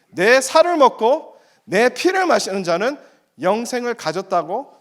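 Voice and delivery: slow, high, firm declaration; firm and decisive. Acted. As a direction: say it with unshakable certainty. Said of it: "단호하게 말씀하십니다".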